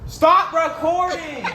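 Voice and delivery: high, loud, drawn-out protest; exasperated yell. Acted. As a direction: stretch it out as you yell at the camera operator. Orders camera off after failure.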